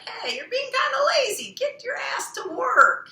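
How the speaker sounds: high, loud nagging inner voice; inner critic voice. Retold or imitated. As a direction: bark it like a bossy inner critic.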